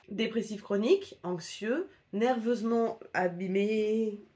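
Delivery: ordinary delivery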